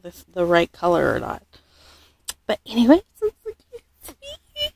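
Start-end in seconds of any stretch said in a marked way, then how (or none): 3.1-4.7 high cooing. Real, not acted. Mom cooing to viewers over his feet. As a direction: coo like to a newborn.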